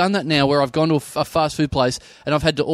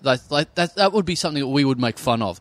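ordinary talk

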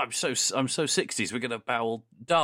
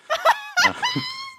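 high, loud burst of laughter; shrieking laugh; 6 dB louder than usual. Real, not acted. Host laughs at co-host's joke, shrieking. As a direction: let out a shrieking cackle.

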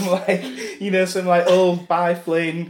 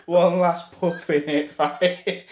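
usual speaking voice

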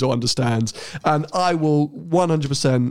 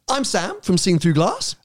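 ordinary delivery

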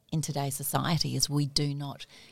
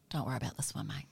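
low, softer reassuring mate; soft conspiratorial reassurance; 3 semitones lower, 8 dB quieter, faster than usual. Retold or imitated. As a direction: tell him it's fine, quietly. Mother comforts son conspiratorially.

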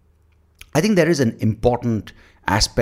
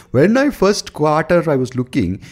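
slow, high Indian presenter with put-on American accent; loud mocking Indian-American accent, drawn out; 9 semitones higher, 6 dB louder than usual. Indian presenter facing away from the audience, in mock American-tinged accent. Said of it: "if you speak like that".

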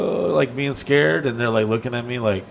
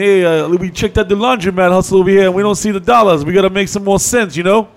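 mob boss; 6 semitones higher, 7 dB louder than usual. Retold or imitated. Mob boss voice demanding cut.

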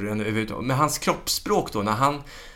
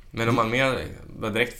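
ordinary talk, nothing marked

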